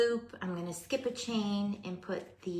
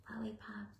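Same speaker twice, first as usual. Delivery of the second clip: whispering; 3 semitones higher, 7 dB quieter than usual. Real, not acted. Whispered to self.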